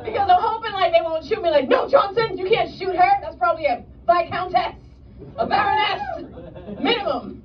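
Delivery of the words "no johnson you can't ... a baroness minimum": high, hammed-up period-drama aristocrat; mock-aristocratic indignation, pitched up; Bridgerton character. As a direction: plead like an alarmed costume-drama noble. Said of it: "I turn into a Bridgerton character".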